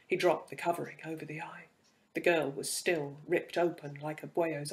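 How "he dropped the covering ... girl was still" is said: ordinary delivery, nothing marked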